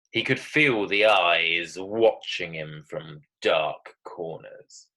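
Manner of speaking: slow, drawn-out key words with pauses; suspenseful story reading. Acted. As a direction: read it like a scary tale. Reads her suspense text aloud.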